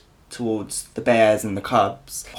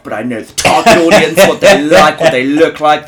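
loud mock boast; 3 semitones higher, 11 dB louder than usual. Real, not acted. Mock-boasting about Scruff users.

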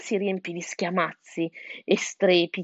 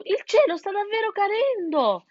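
high, shocked wail; fox's yell; 13 semitones higher than usual. A narrator's reading. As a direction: cry out as the truth dawns. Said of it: "urlò Gustavo". (volpe che esclama sconvolta, voce acuta)

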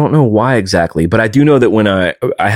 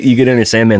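ordinary delivery

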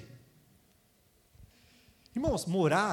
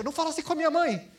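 mock brother; 9 semitones higher, 4 dB louder, faster than usual. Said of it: "o irmão da menina chega".

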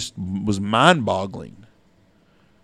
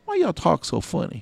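put-on twelve-year-old kid; 8 semitones higher than usual. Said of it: "they were pretty funny talking to 'em".

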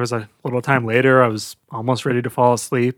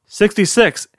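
raised-pitch mock call; 7 semitones higher, 3 dB louder than usual. New prisoner trying a joke number, calling out. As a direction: call the number out to the cell block. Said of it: "called out".

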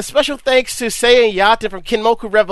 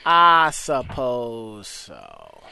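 lower, very slow, trailing off; fading resignation; 9 semitones lower, 4 dB quieter, much slower than usual. Real, not acted. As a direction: let it sink away in defeat.

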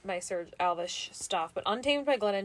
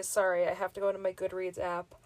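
normal talking voice

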